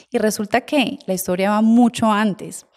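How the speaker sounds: ordinary delivery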